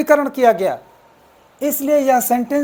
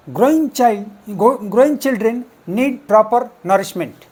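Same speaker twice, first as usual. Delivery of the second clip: slow, deliberate read of the example sentence; teacher reading the test sentence slowly to the class; slow dictation; much slower than usual. Shown as a demonstration. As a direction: read it out word by word. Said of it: "इसमें जो underline word है".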